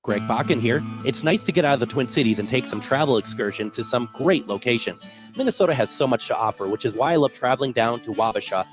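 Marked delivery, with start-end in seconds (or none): none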